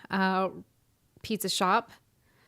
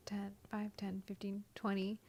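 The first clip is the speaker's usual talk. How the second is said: whispered counting under the breath; 15 dB quieter than usual. Real, not acted. Tallying her jobs to herself, whispering.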